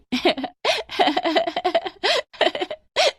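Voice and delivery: high giggling laughter of classmates; children laughing mockingly, high. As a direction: burst out laughing at her. Whole class laughs at Medha.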